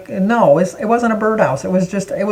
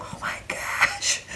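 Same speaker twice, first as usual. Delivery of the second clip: hushed breathy slow gasp of disbelief; stunned whispered oh my gosh; aghast whisper; 13 dB quieter, much slower than usual. Retold or imitated. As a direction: whisper it like you can't believe your eyes. Family aghast at ugly gift.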